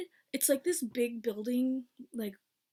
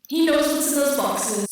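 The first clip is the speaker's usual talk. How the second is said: very loud, raised-pitch yell; shouted outburst; 4 semitones higher, 12 dB louder than usual. Retold or imitated. Delivery man's secret about the box, shouted. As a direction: blurt it out at full volume.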